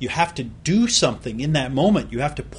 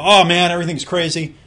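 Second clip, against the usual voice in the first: louder and higher, hammed-up overwhelmed sigh of a busy friend; mock frazzled exclaim; 5 semitones higher, 8 dB louder than usual. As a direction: play the friend who is always swamped, exaggerated.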